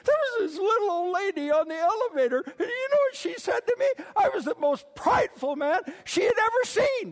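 high, loud tearful wail; mock crying. Crying preacher tells friend about lady, mock wail. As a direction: wail it out in hammed-up sobbing indignation. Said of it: "I'm crying".

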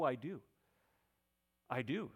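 ordinary delivery

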